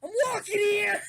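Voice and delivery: high, loud Brooklyn shout; Brooklyn accent. Acted. Riffing on the driver's voice, shouted Brooklyn accent. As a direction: yell it like an outraged Brooklyn pedestrian. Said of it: "he's got a Brooklyn accent".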